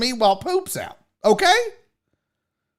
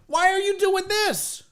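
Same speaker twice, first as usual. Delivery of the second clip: exasperated; 11 semitones higher than usual. Real, not acted. Exasperated at speakerphone people.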